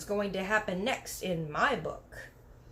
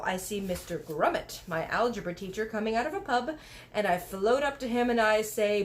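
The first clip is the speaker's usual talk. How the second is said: usual speaking voice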